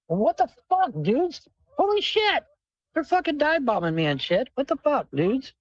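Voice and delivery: high, shocked yells at the birds; startled outburst. Real, not acted. Streamer startled by birds dive bombing him, shocked yelling. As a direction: yelp in real alarm as they swoop.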